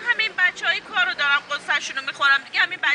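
ordinary talk, nothing marked

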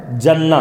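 "جنہ" said as "janna"; short a in the first syllable of "جنہ"; zabar on jim. Shown as a demonstration. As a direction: read "جنہ" with zabar, "JAN-na". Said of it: "زبر آئے گا".

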